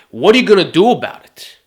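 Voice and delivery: loud, punchy challenge thrown at the viewer; hard-hitting rhetorical question; forceful challenge. Real, not acted. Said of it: "I wanna repeat this question".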